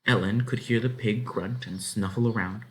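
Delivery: ordinary delivery, nothing marked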